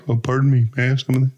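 a hint of a polite character voice; Sadie. Host showing co-host a character, lightly voiced. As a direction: lightly put on a courteous little plea. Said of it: "Sadie's kind of like".

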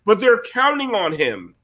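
normal talking voice

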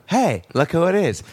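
lightly voiced old Hollywood greeting; a hint of a 1930s showman; old-timey voice. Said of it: "old-timey voice".

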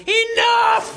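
falsetto yell at a police officer; hammed-up squeaky outburst; mock high-pitched shout. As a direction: screech it way up high. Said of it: "you know, fast, you were going".

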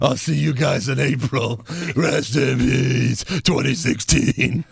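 very slow, drawn-out farewell; wrestler promo impression. Host extending the Undertaker bit, slow mock-solemn promo. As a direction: intone it slowly like a menacing promo.

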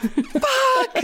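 high panicked curse; panicked yelp. Retold or imitated. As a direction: yelp it in fright.

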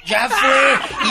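loud laughing burst; laughing shout. Real, not acted.

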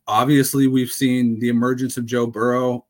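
ordinary talk

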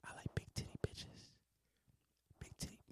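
tiny, very soft whisper; ASMR whisper. Pretend. Host offering listeners some ASMR, joking whisper. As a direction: whisper right into the mic. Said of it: "Y'all wanna hear some A S M R".

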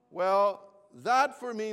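ordinary talk, nothing marked

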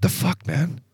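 high-pitched mock protest; retold indignant confrontation. Crashed skier confronts his buddy at the bottom, retold indignant protest.

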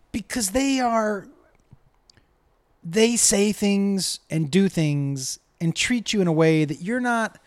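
usual speaking voice

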